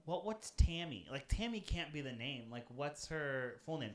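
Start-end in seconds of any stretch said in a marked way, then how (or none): none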